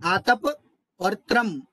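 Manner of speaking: आतप, a pause, then त्रम on its own; separated syllables. Shown as a demonstration. Teacher showing the split, आतप and त्रम said separately. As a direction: keep आतप clearly apart from त्रम. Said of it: "इस तरह अलग रखना चाहिए".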